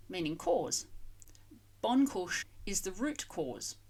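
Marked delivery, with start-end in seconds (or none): 1.8-3.8 Bonchush said slowly, first syllable bon stressed; careful pronunciation. Shown as a demonstration. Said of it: "with that first syllable".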